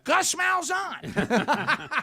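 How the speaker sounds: drawn-out, slow name reveal; slow dramatic reveal. Acted. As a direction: stretch the name out slowly.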